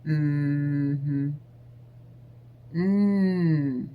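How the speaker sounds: flat, minimal grunts and one-word answers; deadpan noncommittal replies; bored mouth noises. Shown as a demonstration. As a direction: give him nothing but noncommittal hums. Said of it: "mouth noises are best".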